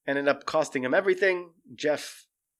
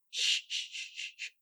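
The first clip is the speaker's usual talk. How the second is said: whispered, soothing shh sounds; shushing; 11 dB quieter than usual. Retold or imitated. Joe whispering shh to soothe the husband. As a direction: hush him like a calmed animal.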